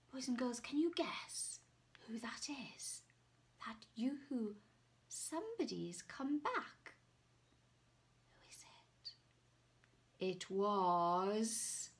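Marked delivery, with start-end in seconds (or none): 0.1-9.1 whisper. Real, not acted. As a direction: lean close and whisper. Whispering to children.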